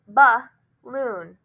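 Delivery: BALLOON split in two, first syllable a short "buh"; deliberate teacher's breakdown of BALLOON; syllables apart. Shown as a demonstration. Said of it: "We say that first syllable kinda shortened".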